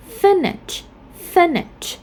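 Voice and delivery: "finish" stressed on FIN; "finish" as FIN-ish, twice; first-syllable stress. Shown as a demonstration. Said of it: "we stress at the first syllable".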